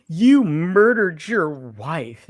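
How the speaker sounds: loud, very drawn-out mock accusation; theatrical public denunciation; hammed-up accusation. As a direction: stretch each word high, imitating her meltdown. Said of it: "And she loses her shit".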